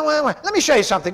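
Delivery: usual speaking voice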